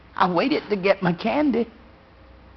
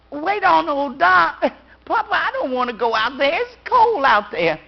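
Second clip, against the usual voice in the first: put-on high, whiny little girl's protest; child protesting; 6 semitones higher, 3 dB louder than usual. A little girl begging her papa not to send her out, high and whiny. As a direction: plead not to go out in the cold.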